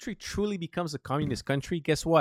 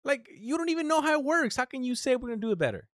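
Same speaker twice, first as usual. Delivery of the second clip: exasperated rant; 11 semitones higher than usual. Retold or imitated. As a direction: fire it back at them, incredulous. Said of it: "It's like".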